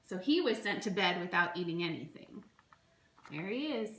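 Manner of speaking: ordinary delivery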